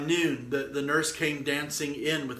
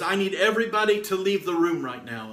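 retold firm command, higher and louder; raised, commanding voice for a quoted order; rising up boldly; 4 semitones higher, 5 dB louder than usual. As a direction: quote a girl suddenly taking charge of the room. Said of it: "she just rises up in this moment".

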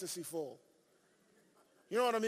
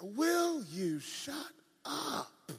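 very slow, drawn-out rebuke; exasperated Holy Ghost; 3 semitones higher, much slower than usual. Retold or imitated. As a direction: stretch each word in exasperation. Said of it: "my Holy Ghost is different".

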